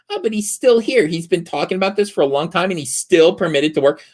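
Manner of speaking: high-pitched, indignant complainer voice; mock gossip. Cancellers complaining in private about someone, mock-indignant high voice.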